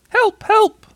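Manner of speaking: very high, loud little voice; squeaky cry for help. A narrator's reading. Piglet underneath Pooh calling out, squeaky. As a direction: squeal for help, twice over. Trapped Piglet calls again.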